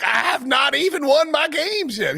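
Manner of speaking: high, slow, declaimed mock Southern; exaggerated Southern accent. Mocking the coach's speech, hammed-up Southern accent. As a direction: parody a pompous Southern orator, pitched high. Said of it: "He sounded like, uh, Foghorn Leghorn".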